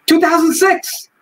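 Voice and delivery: milk the number like a punchline, high and slow; inmate announcing a number. Retold or imitated. Inmate calling a joke number across the mess hall, high and slow. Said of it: "After a while".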